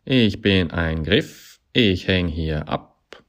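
slow drawn-out character voice, stretching the words; talking climbing hold. A narrator's reading.

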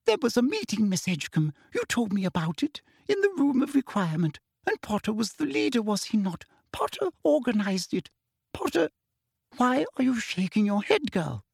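shrill, rising fury, high pitched; losing her sugary composure; Umbridge voice. Acted.

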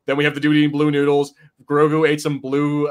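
ordinary delivery

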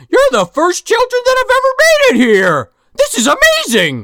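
very high, gushing fake delight; hammed-up wonder at the kids; mock excited Santa. Santa greeting arriving children.

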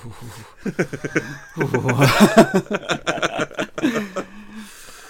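loud helpless laughter; long loud laugh. Real, not acted. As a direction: laugh out hard.

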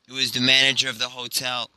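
plain reading voice

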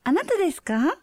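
high polite phone voice. Shown as a demonstration. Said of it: "do your phone voice".

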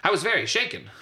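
"very shaken" shouted high and loud; exclaimed. Shown as a demonstration. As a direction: cry out "I was very shaken" with an exclamation point. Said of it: "exclamation point".

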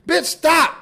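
high, sudden cry to stop; flustered protest. Acted. Young man overwhelmed by dirty talk, flustered. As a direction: cut her off in mock panic.

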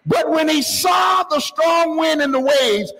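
impassioned preaching, pitched high; predicazione infervorata. Real, not acted. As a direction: preach it with force, drawing out key words. Preacher at full force.

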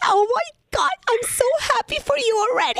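high excited gushing. Acted. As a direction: gush with delight. Prank caller gushing with joy.

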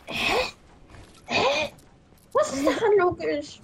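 high-pitched, incredulous "huh?"; baffled. Real, not acted. Co-host reacting to a joke that makes no sense, baffled. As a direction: question it in high-pitched disbelief.

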